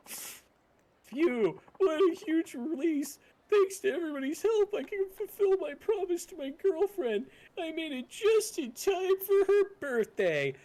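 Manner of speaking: put-on high eager boyfriend. Boyfriend voice again.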